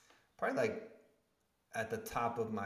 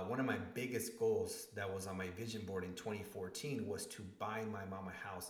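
ordinary talk

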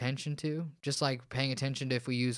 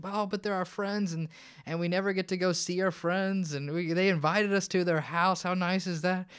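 retold sweet-talking, pitched up and pleading; coaxing plea; 7 semitones higher, 3 dB louder than usual. To his exhausted wife on the floor, mock-pleading coaxing. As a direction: coax a tired wife into going out.

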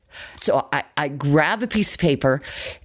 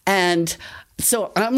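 normal talking voice

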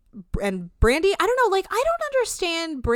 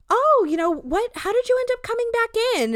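much higher, louder syrupy voice; fake-sweet mean girl; 5 dB louder than usual. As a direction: fake sweetness hiding a jab. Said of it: "Lacey's like".

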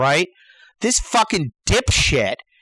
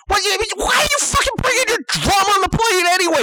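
fast, high-pitched exasperation; worked-up comic tirade; outraged demand; 13 semitones higher, faster than usual. Acted. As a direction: rattle it out, high and incensed. Acted outburst at drum owner.

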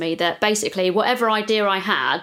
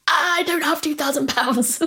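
dismayed protest; 8 semitones higher, slower than usual. Retold or imitated. Quoting her own reply to the landlord.

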